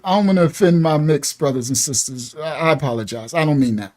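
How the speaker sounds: hushed, contrite aside; careful apology. Real, not acted. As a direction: drop your voice and apologize carefully. Backpedals with an apology to the audience.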